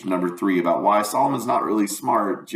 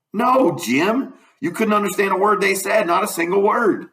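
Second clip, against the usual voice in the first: put-on high-pitched country boy dialect; emphatic high Southern character voice; Huck, emphatic; 6 dB louder than usual. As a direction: insist on it in a higher boyish voice. Voices Huck correcting Jim.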